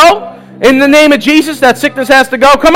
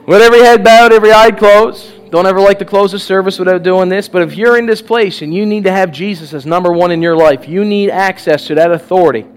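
soft, lower, hushed invitation; altar call; 6 semitones lower, 3 dB quieter than usual. Real, not acted. Pastor quietly inviting people to raise hands. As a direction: invite them gently, heads bowed.

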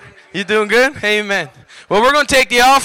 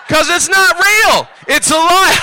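slow, raised exclamation; fired-up preaching; 5 semitones higher, slower than usual. Real, not acted. Preacher urging the crowd to laugh, fired up. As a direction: drive it home, high and drawn out.

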